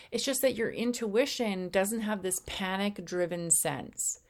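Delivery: ordinary talk, nothing marked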